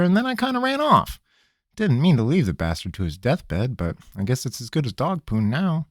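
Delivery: a cornered trickster pleading; nervous country rogue. Acted. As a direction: squirm and stall, talking fast. Pickett voice.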